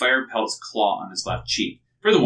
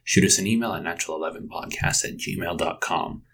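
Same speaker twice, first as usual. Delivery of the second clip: upbeat announcer sign-off; podcast outro; 5 dB louder than usual. Acted. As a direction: read it like a show host wrapping up.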